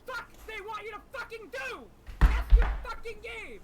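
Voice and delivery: furious shouting, rising pitch; raging gamer. Real, not acted. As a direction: scream it at the game in fury. Raging at the game.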